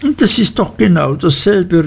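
high, indignant outcry; high indignant exclamation from the young wife; exclaiming. A narrator's reading. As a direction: cry it out, offended. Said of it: "rief Dora aus".

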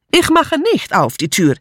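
high-pitched defiant call; high, indignant accented cry. A narrator's reading. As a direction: call a refusal from the next room. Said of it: "rief Mitzi aus der Küche".

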